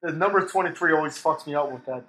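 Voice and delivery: ordinary delivery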